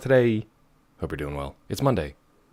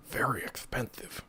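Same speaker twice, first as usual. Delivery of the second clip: whispering; 2 semitones higher than usual. Acted. Whispered repeat with laugh.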